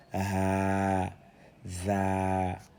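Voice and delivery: "Ha" and "Za" each held long and level; lengthened vowels. Shown as a demonstration. Teacher reading the lengthened syllables, "Ha", "Za" drawn out. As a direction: stretch each of "Ha", "Za" on a steady note. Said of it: "sert d'outil d'allongement".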